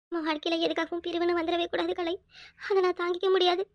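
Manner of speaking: hushed, intimate and weak; soft, tender pleading. Acted. Drowsy wife begs husband never to part, softly. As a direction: plead gently with little strength left.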